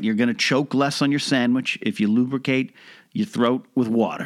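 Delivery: ordinary talk, nothing marked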